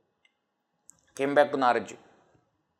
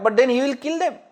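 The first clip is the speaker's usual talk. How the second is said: ordinary talk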